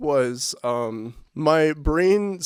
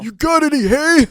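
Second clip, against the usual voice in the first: put-on high, loud old grandpa voice; croaky old farmer asking; old man voice; 12 semitones higher, 10 dB louder than usual. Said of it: "exchange that dialogue".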